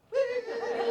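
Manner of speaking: very high cheer; whoop. Retold or imitated. Teacher whooping after his joke, very high. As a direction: throw out a happy whoop.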